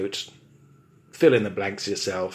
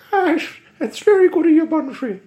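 high, loud, fast pleading stage Irish; leprechaun Irish accent; 12 dB louder, faster than usual. Acted. Leprechaun begs Bumfrey for release in Irish accent. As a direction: beg in a squeaky Irish leprechaun voice. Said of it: "lay on the accent so thick".

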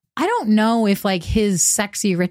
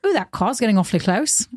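ordinary talk, nothing marked

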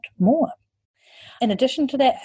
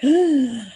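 sharp, high, loud gasp of disbelief; a high startled intake of breath; shocked gasp; 9 semitones higher, 4 dB louder than usual. Retold or imitated. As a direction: gasp as if the news is outrageous. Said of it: "everybody in the conference was like".

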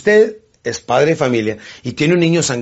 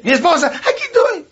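wife answering; 14 semitones higher than usual. Acted. Wife answering roll call.

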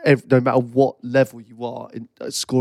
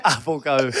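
usual speaking voice

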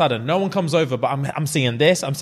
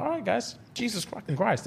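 higher, softer exasperated aside; exasperated mutter; 5 semitones higher, 9 dB quieter than usual. Pretend. Referee grumbling at the players, soft exasperated aside. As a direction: grumble under his breath at the players.

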